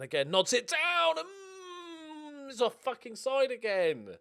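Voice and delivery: high, loud yell collapsing into outrage; excited shout turning to frustration. Real, not acted. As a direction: cry out at a goal snatched away. Goal ruled offside during play.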